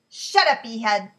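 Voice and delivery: angry snap, near a yell; snapped. A narrator's reading. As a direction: bark it at him to shut up. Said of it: "Moshe snapped".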